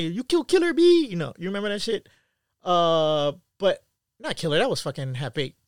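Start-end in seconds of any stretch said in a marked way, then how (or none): 0.0-1.1 high, loud quote of the movie line; much higher, louder movie quote; movie line impression. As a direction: belt the famous line like the film. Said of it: "just like in, uh, Next Friday".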